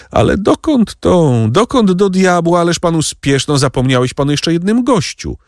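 high-pitched, impatient protest; calling him back. A narrator's reading. Count stops his steward from leaving too soon, calling out. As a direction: call after someone rushing off.

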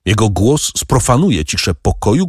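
usual speaking voice

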